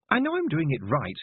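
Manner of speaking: a high, mildly frustrated complaint; a girl whining that it won't work; a touch of complaint; complaining. Acted. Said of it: "Tiffany complained".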